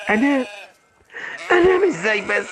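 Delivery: high, disbelieving repeat; can't believe she says sleep; outraged question; incredulous. Acted. Husband incredulous at being told sleep.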